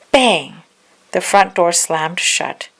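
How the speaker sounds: ordinary delivery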